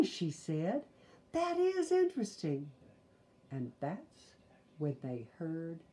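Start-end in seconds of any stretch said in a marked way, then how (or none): 1.3-2.7 excited. Acted. Sister delighted.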